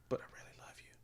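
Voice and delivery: hushed whispered plea. Retold or imitated. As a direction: breathe it out secretly.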